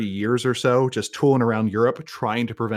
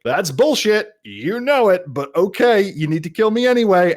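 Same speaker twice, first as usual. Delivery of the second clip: higher, louder indignant comeback; defiant retort; 8 semitones higher, 5 dB louder than usual. Retold or imitated. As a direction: throw it back at the accusers, raised voice. Acting out Hillel's defiant retort.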